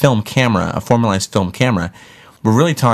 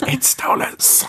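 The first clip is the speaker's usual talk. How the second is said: whispering; 5 dB quieter than usual. Acted. Spooky joke about demon magic.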